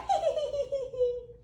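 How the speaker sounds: high, helpless belly laugh; big laugh. Acted. Critters rolling on their backs, laughing at the gator. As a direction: laugh till you cry. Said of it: "they laugh until they cry".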